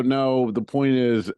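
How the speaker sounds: frustrated arguing, retold; exasperated retold objection. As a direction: push back slowly and forcefully. Retold argument with guest relations.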